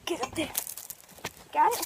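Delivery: usual speaking voice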